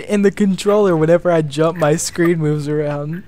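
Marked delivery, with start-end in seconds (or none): none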